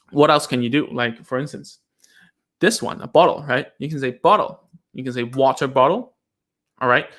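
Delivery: normal talking voice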